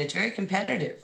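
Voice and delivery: normal talking voice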